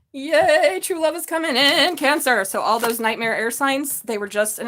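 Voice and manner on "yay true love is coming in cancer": high, loud happy announcement; beaming excitement; excited. Real, not acted. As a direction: cheer the good news to the viewers. Celebrating love for Cancer.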